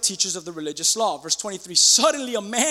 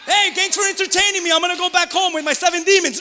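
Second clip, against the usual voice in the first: high, loud mock-cheery churchgoer; mock satisfied visitor; 9 semitones higher, 6 dB louder than usual. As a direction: call it out high like a happy customer.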